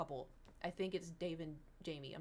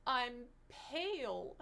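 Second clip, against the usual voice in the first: slow, higher, tragic mock voice; dramatic mock lament; 4 semitones higher, much slower than usual. Retelling the bride collapsing on the ground, in a slow, hammed-up wail. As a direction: draw it out like a tragic confession. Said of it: "she turns around and she goes".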